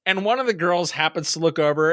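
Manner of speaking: normal talking voice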